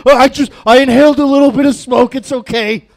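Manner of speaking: hammed-up coughing-fit voice, easing off; strained through retching. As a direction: choke out a reassurance while gagging. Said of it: "and I'm going".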